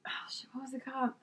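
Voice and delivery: whispered aside to herself; quick unvoiced whisper trying to recall a name. Real, not acted. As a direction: drop to a breathy whisper while searching memory.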